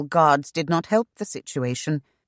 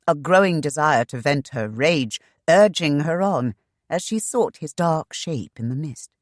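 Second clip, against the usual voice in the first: ordinary delivery, nothing marked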